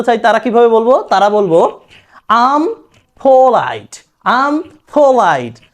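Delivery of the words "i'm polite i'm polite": slow, drawn-out drill phrase; slow classroom repetition; slow practice drill. Shown as a demonstration. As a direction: say it very slowly for the class to repeat. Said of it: "তাহলে কি বলতে পারি?".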